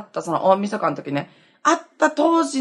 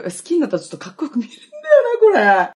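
laughing; 9 semitones higher, faster than usual. Real, not acted. Giggling over her crush.

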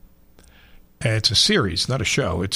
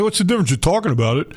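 mock objecting listener; 7 semitones higher, faster than usual. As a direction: play the pushy caller, pitched up. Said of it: "You say".

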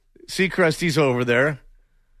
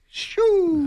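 high, drawn-out speeding shoo; imitated cartoon dash-off noise; Roadrunner whoosh. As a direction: zip away with a high whoosh. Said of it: "he was going like the Roadrunner".

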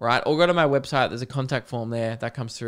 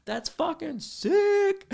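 very high, giddy squeal; proud excitement; slower than usual. Retold or imitated. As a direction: gush like a thrilled boyfriend. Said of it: "I'm like".

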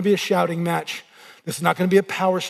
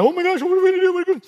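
high, frantic mock panic; 10 semitones higher, much faster than usual. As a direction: play the frantic worrier, pitched way up. Frantic believer imitated.

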